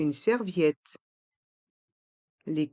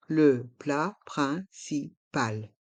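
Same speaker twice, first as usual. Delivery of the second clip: "Le plat principal" in slow, separate syllables; slow model pronunciation; slower than usual. Shown as a demonstration. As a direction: pause after every syllable of "Le plat principal". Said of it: "Il faut bien prononcer".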